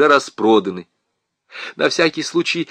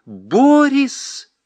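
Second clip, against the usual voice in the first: higher cry of his name; calling out; 13 semitones higher, 3 dB louder than usual. A narrator's reading. Wife cries out to her husband. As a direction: call out his name in alarm. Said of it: "закричала она".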